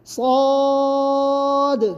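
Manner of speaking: long recitation صاد; recited letter. Shown as a demonstration. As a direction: draw صاد out slowly. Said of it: "teacher recites letter".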